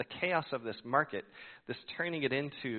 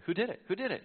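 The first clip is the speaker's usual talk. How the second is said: higher, louder repeated question; demanding; 9 semitones higher, 5 dB louder, faster than usual. Retold or imitated. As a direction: press for the culprit, raised voice. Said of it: "John's like".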